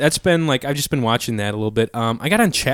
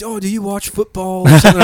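put-on British accent; 8 semitones higher, 4 dB louder than usual. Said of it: "He had a pretty sick accent".

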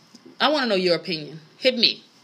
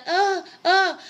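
high, breathy-sounding mock moans with a laugh; hammed-up moaning of a porn actress; 8 semitones higher than usual.

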